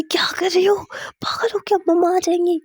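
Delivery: high, urgent acted protest; alarmed scolding. Wife silencing her partner before mother comes, alarmed high scolding. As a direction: stop her before Mumma hears. Stops her calling out for Mumma.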